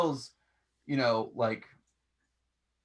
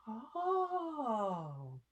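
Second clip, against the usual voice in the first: surprised little oh; 8 semitones higher, 6 dB quieter than usual. Acted. GM voicing surprised elf girl.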